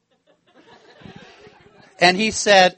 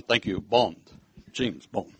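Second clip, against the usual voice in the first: low, cool spy voice; a lightly voiced suave secret agent; James Bond; 11 semitones lower, 8 dB quieter, slower than usual. Preacher playing Bond at passport control, low and cool.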